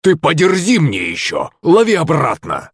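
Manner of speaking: high, strained character yell; high-pitched angry cry, little added volume; straining shout. A narrator's reading. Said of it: "выкрикнул Захарыч, надрывая горло".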